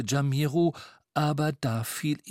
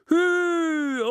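high, loud cry to the reindeer; shouted command; 6 dB louder than usual. A narrator's reading. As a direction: urge the team off with a long high shout. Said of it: "Jamiro nahm die Zügel und rief". (hoher, lauter Anfeuerungsruf)

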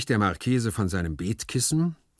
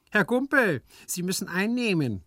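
much higher, louder servant voice; interrupting servant; 12 semitones higher, 4 dB louder than usual. A narrator's reading. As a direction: cut in sharply on the master's rapture. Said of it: "unterbrach Hyacinth die pathetische Deklamation". (hoher, lauter Einwurf des Dieners)

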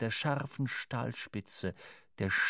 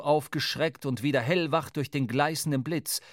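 normal talking voice

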